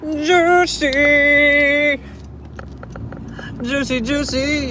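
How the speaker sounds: high shout of Juicy. Pretend. Screaming Juicy out the window.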